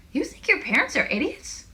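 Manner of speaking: high, loud disbelief; incredulous question. A narrator's reading. Squeezy challenges Asha about her parents, incredulous. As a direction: question leaping up in pitch. Character incredulous at Asha's remark.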